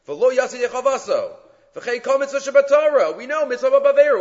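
rising to a loud, high preaching pitch; emphatic. Real, not acted. Rabbi driving home his point.

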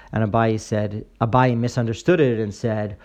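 usual speaking voice

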